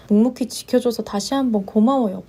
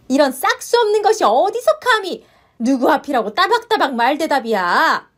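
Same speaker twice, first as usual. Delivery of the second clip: outraged mother-in-law; 5 semitones higher than usual. Acted. Mother-in-law explodes at her daughter-in-law's back talk.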